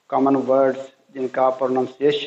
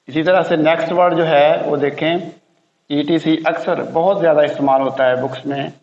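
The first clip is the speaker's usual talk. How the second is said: ordinary talk, nothing marked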